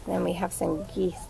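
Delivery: ordinary delivery, nothing marked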